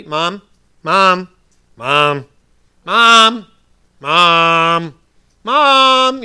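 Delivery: put-on loud, insistent kid nagging for a parent; annoying child calling. Child in bed calling Mom over and over, annoyingly insistent. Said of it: "That's just annoying".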